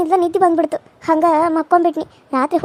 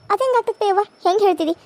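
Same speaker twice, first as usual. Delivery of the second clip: ordinary talk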